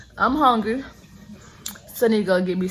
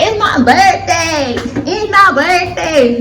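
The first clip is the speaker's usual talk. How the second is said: put-on high, drawn-out sassy girl voice; mock the birthday girl, slow and high; ratchet voice; 8 semitones higher, 6 dB louder, much slower than usual. Vlogger mocking a ratchet birthday girl, high and drawn out. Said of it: "that ratchet voice".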